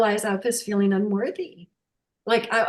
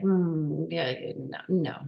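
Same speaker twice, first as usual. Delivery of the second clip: dismissive; 4 semitones lower, 5 dB quieter, slower than usual. Retold or imitated. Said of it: "I'd be like".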